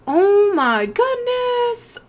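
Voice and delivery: very slow, high, stretched-out awe; awed. Real, not acted. Seeing the gorgeous ocelot, slow stretched-out awe. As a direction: draw it out, overwhelmed.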